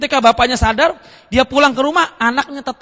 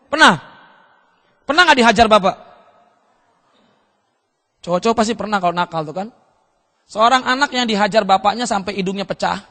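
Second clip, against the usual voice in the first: ordinary delivery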